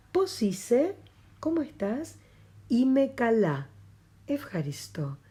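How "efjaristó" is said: whispered word. Shown as a demonstration.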